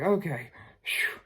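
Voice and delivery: whispered okay. Retold or imitated. Relieved reply, whispered.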